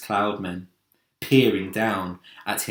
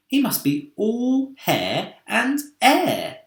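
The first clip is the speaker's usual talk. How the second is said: delighted; 10 semitones higher, slower than usual. A narrator's reading. James delighted, watching cloudman.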